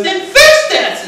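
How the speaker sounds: high, loud, drawn-out demand; mock-angry high yell; exasperated nurse. Nurse shoving the computer at patient, mock exasperated yell.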